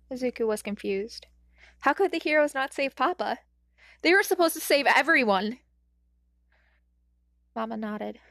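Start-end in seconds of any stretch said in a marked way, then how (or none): 1.8-5.6 upset disbelief. A narrator's reading. Confused inner thought.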